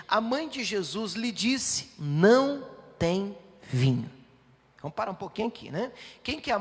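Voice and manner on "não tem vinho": emphatic scripture line. Acted. Reading Mary's line emphatically.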